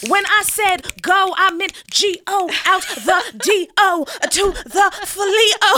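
slow, high dramatic slam poet; spoken word performance, drawn out. Pretend. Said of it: "you talk like this and then you talk like that".

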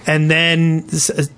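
ordinary talk, nothing marked

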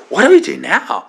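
retold flustered appeal for help; mock panic. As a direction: blurt it out at a loss. Alarmed question to the pastor.

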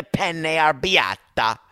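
relishing the dish in a hammed-up Italian accent; mock Italian accent. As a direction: roll it out like a movie Italian. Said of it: "all speaking in this crazy accent".

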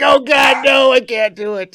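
high loud anguished scream. Retold or imitated. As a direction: scream it in mock despair. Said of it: "I screamed".

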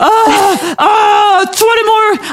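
high, loud, breathless overwhelmed instructor; losing count mid-class, near tears; panicked high shouting coach; frazzled coach. Pretend. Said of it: "if I had to do you right now, I'd be like".

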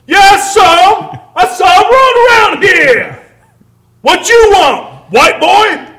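high, loud, slow hammed-up tough guy; aggressive street witness impression. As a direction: drag it out high and loud, in his face. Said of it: "super aggressive".

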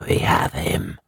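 low savage voice jumping upward; triumphant savage. A narrator's reading. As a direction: gloat as one of the ghostly horde, from low in the throat. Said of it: "whirled round him in triumph and shouted".

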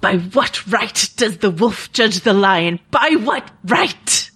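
slow, theatrical quote with a rising end; Jamie Lannister. Acted. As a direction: deliver it as grand, indignant stage drama. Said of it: "do my best Jamie Lannister here".

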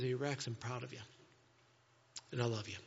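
low, soft voice of fatherly pride; quiet, heartfelt reassurance; tender affirmation. Acted. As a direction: speak softly and intimately, as if arm in arm. Imagined Jesus embracing and affirming him.

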